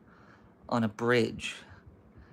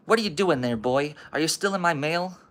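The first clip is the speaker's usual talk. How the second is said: put-on higher, louder old-guy demand; stern challenge; 5 semitones higher, 4 dB louder than usual. Old guy challenging boy at his mailbox, stern. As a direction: confront the boy with sharp questions.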